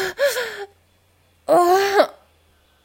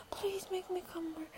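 unvoiced pleading whisper; 17 dB quieter than usual. Real, not acted. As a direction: repeat it as a breathy whisper. Repeated plea whispered.

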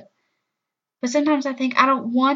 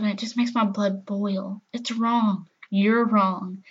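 ordinary delivery

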